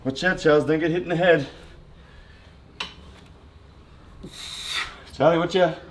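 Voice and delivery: talking to the dog. Real, not acted. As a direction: call out to the dog playfully. Calling to the dog.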